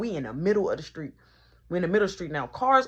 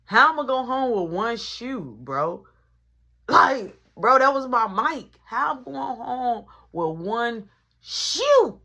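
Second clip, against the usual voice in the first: raised, exasperated outburst; indignant retold protest; 3 semitones higher, 6 dB louder, slower than usual. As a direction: protest loudly, building in disbelief. Protesting having only one shoe.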